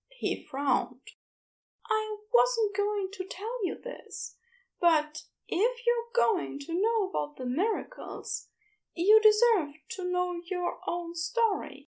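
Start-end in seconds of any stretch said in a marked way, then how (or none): 1.9-11.9 Aziraphale voiced higher, hesitant then resolved; higher Aziraphale breaking hard news. A narrator's reading. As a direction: lift the voice for the gentle angel confessing. Aziraphale confides in story.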